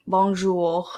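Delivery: "Bonjour" with the R made in the back of the throat; French pronunciation. Shown as a demonstration. Vlogger showing viewers the French R, throaty "Bonjour". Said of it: "R sound in the back of their throats".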